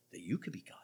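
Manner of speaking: hushed, low whisper; a secret warning, breathed low. Real, not acted. Preacher warns the congregation of Satan in a whisper.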